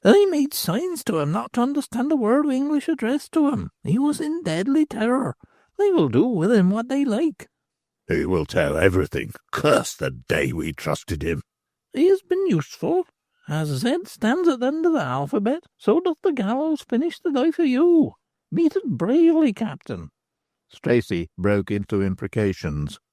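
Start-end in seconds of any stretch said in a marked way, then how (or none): 0.0-7.5 much higher, cackling crone voice; a knowing old beldam; old hag's voice. Acted. 8.1-11.4 a worried man swearing under his breath; Stracey's voice. Acted. 11.9-20.1 much higher, cackling crone voice; a knowing old beldam; old hag's voice. Acted.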